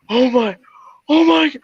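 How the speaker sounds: high-pitched, tearful imitation of a meltdown; exaggerated high sobbing voice; mock losing it. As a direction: wail it like his world is collapsing, hammed up. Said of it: "he was losing his damn mind".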